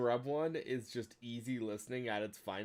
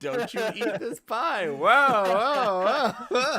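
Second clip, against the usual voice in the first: much higher, louder, slow then whoa cries; hammed-up meme quote; 10 semitones higher, 10 dB louder, much slower than usual. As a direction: ham up the pie line. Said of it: "quoting the meme".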